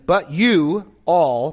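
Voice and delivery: slow, pointed "you all"; stress on you all. Shown as a demonstration. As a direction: lean hard on "you all" to show it's plural.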